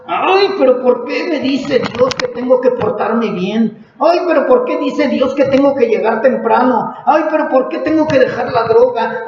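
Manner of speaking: whiny complaining. Retold or imitated. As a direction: whine each question like a spoiled child. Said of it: "empiezas a llorar de coraje".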